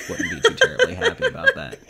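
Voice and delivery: teasing through laughter; giggling while teasing; laughing. Real, not acted.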